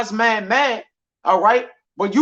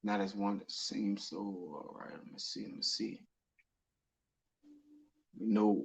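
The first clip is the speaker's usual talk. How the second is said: muttered to himself; 14 semitones lower, 20 dB quieter than usual. Real, not acted. Muttering while searching for verse.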